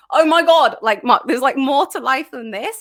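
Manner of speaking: loud, high "oh my God"; a sudden burst of realisation; exclaiming. Retold or imitated. Realising there's more to life.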